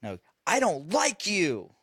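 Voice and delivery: put-on slow, whiny kid voice; imitating whiny son. Mimicking his son's sulky complaint when not getting his way. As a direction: pout it out like a sulking five-year-old. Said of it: "he goes".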